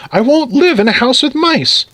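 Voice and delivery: high-pitched, agitated protest; distressed wife. Acted. Wife insists mice must go.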